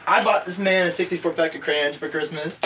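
normal talking voice

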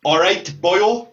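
put-on slow Welsh, long last O in "boyo"; Welsh accent. Shown as a demonstration. Host giving guest a phrase to copy, Welsh accent. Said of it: "Just say".

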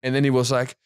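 ordinary delivery

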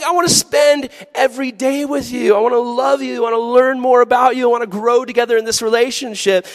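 high, loud earnest pleading; eager devotion. Pretend. Devoted teen tells crush, high and loud. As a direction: pour your heart out.

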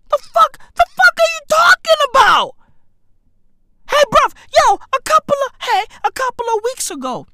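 high, loud incredulous yelling; hammed-up high-pitched shouting; outraged disbelief. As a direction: shriek it in comic outrage. Acting outraged disbelief at the rumour.

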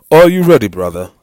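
high, booming call dropping on the last word; loud challenge. A narrator's reading. Young man challenging his brother, loud high call.

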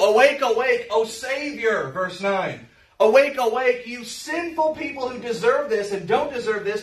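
proclaiming the verse with force; emphatic scripture reading. Acted. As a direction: ring it out like a summons. Reads scripture, preaching force.

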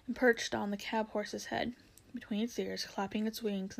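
plain reading voice

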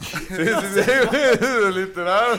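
se ríe a carcajadas al hablar; riendo. Real, not acted. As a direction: suelta las palabras entre risas fuertes. Riendo tras la broma. (diciéndolo entre carcajadas)

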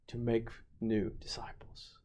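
hushed whisper on make new disciples; whispered emphasis. Real, not acted. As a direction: drop to an intimate whisper. Whispered close.

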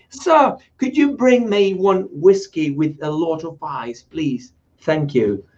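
slow, careful polite English; careful English request; slow English order. Retold or imitated. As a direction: order the whiskey slowly and politely.

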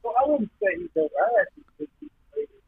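choppy, breaking-up phone line; bad connection cutting out. Real, not acted. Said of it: "you're breaking up a little bit there".

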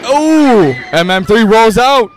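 wrestling commentator. Acted. Mock wrestling play-by-play call.

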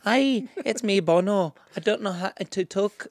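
lightly put-on Irish rock star; [name] voice. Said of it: "I don't know how to do these vo".

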